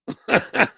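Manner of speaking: short high laugh at the scam; cracking up at the joke; high burst of laughter. Real, not acted.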